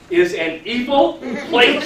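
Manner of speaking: high, slow and laughing; laughing mock warning. Real, not acted. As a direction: tease the daughters with a grin. Joking the mall is evil.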